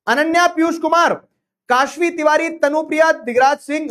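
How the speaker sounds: high, loud announcer-style name reading; leaderboard roll-call. Real, not acted.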